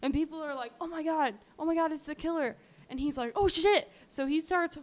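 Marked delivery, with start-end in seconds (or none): 0.8-2.6 higher-pitched imitation of shocked bystanders; mildly panicked retold exclamation; mock alarm. Retold bystanders recognising the killer. 3.3-3.9 high, loud startled exclamation; imitated burst of alarm; retold panic. Retold killer realising he's spotted.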